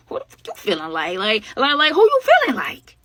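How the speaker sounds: can't believe what he's hearing; incredulous outburst. Retold or imitated. Storyteller reacting to Callie's threats, disbelieving outburst.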